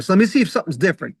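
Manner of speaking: usual speaking voice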